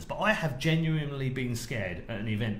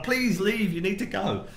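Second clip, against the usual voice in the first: higher and louder, pleading; urgent retold shout; 8 semitones higher, 4 dB louder, slower than usual. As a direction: desperately order him off the set. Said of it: "I'm like".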